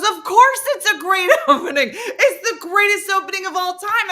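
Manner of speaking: loud high outburst broken by laughter; exasperated. Retold or imitated. Partner conceding it's a great opening, exasperated. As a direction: imitate him exploding while cracking up. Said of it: "his exasperation".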